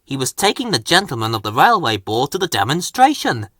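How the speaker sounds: usual speaking voice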